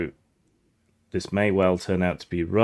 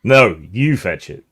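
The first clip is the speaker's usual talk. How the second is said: louder order, punching "you"; firm acted command, "you" swooping up; insistent guard; 6 dB louder than usual. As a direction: throw the order back with weight on "you". Guard throws the order back.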